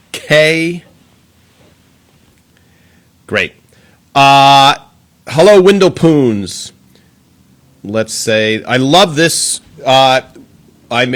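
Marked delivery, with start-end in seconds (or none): none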